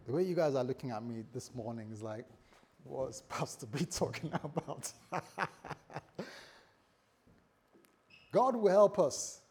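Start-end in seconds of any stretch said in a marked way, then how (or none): 2.8-4.9 laughing mock question. Voices puzzled congregation, breaking into laughter. 5.0-6.4 breathless chuckle; laugh. Real, not acted. Laughs at his own joke.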